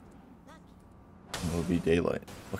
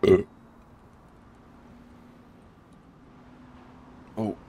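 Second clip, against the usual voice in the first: hiccuping mid-word; small hiccup catches; 5 dB quieter than usual. Real, not acted. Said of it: "I'm hiccuping".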